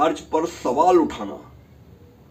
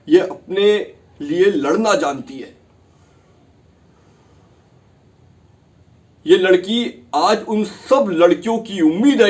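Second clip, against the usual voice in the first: louder and higher, declaiming to the bench; rally the courtroom behind the girl; impassioned plea; 4 semitones higher, 7 dB louder than usual. Acted.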